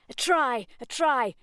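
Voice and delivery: high, loud triumphant yell; gleeful rugby yell. Acted. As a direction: shout it like scoring. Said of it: "yelled Freddy".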